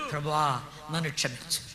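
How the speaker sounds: low, soft plea for forgiveness; soft penitent prayer. Shown as a demonstration. Preacher showing the congregation a quiet prayer, soft penitent plea. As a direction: murmur it like a humble confession.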